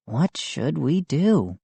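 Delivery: usual speaking voice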